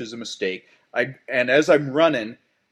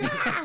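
very high startled yelp; a sudden squeal of alarm; panicked yelp. Retold or imitated.

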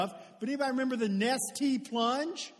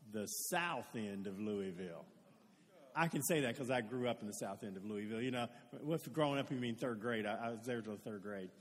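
much lower, put-on Louisville voice; 12 semitones lower, 9 dB quieter than usual. Joking about his hometown.

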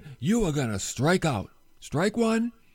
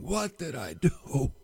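broken remorse trailing off; despairing whisper; 7 dB quieter than usual. Acted. Peter weeping over his denial, whispering in despair. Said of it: "crying and weeping".

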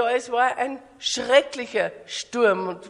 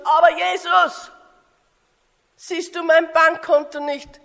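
higher, loud indignant pleading; desperate protest; 4 semitones higher, 4 dB louder than usual. Acted. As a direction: argue urgently with Jesus. Acted believer protests to Jesus about troubles. (aufgebracht und verzweifelt klagen)

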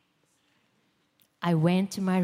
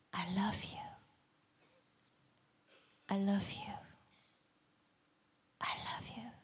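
softer and softer until only a whisper; fading whispered prayer; 12 dB quieter than usual. Retold or imitated. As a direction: let "I love you" melt away into a whisper. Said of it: "and I said".

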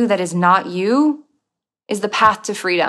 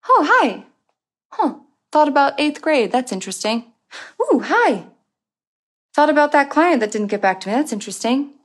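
higher, light and amused greeting to each thought; curious noticing; 6 semitones higher than usual. Pretend. Aware mind noticing its own thoughts, in a light curious voice.

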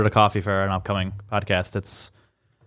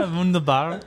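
put-on German accent echo; 5 semitones higher than usual. Echoes the mock German.

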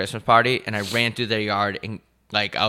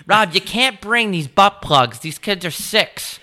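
scolding volunteer; 11 semitones higher, 5 dB louder, slower than usual. Retold or imitated. Toy drive worker scolding.

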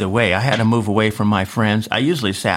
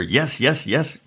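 mild retold celebration; 5 semitones higher, slower than usual. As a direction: savor the win under your breath. Celebrating his report card.

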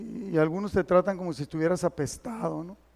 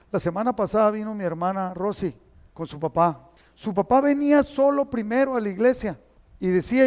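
ordinary delivery

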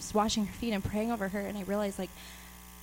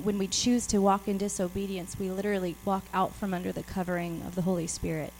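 normal talking voice

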